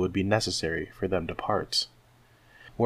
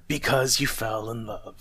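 mildly growled mocking echo; a touch of a curmudgeon's growl; 2 semitones higher than usual. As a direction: throw it back with grumpy scorn. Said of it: "growled Scrooge".

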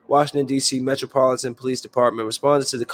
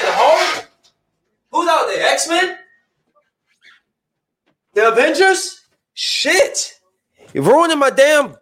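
much higher, mocking, incredulous yelling at the noise; exasperated rant; 14 semitones higher than usual. Real, not acted. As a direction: vent at the helicopter overhead. Ranting at helicopter noise.

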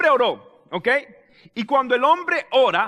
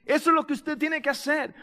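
ordinary talk, nothing marked

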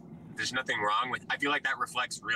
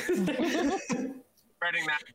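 laughing; 12 semitones higher, much slower than usual. Real, not acted. Laughing after Fairly Odd Parents joke.

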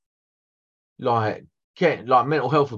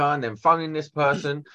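normal talking voice

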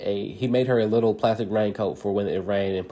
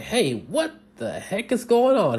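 higher, slow, startled grown-up; mock startled man finding his lawn full; surprised Mister Barry; 12 semitones higher, slower than usual. Mister Barry finds everyone on his sofa, higher startled shout. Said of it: "he asked".

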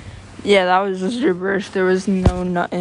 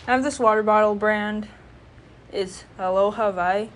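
ordinary talk

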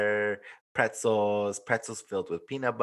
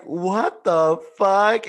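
slow, loud, high disbelief; mock shocked; 10 semitones higher, 9 dB louder, much slower than usual. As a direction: drag it out in amazement. Coworker's shocked reaction retold.

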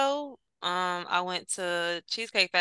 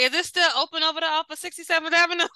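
higher, fast eager asking; eager question; 8 semitones higher, faster than usual. Retold or imitated. Asking about the Tony Roma's location, eager. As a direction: ask it quickly, all excited. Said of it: "I'm over here like".

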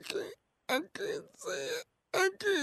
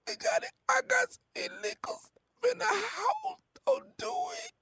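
higher, tearful whispered begging; desperate pleading; 9 semitones higher than usual. Acted. Caller begs host not to call police, tearful. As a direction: plead through tears.

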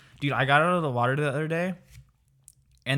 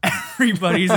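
incredulous; 6 semitones higher, 9 dB louder than usual. Real, not acted. Incredulous echo of odd phrase.

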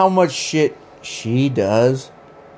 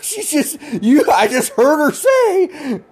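higher, laughing through the retelling; 9 semitones higher than usual. Real, not acted. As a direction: laugh while you tell it.

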